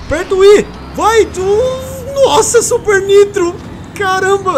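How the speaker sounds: high, loud urgent calls; hurried game shouting; excited gamer. Real, not acted.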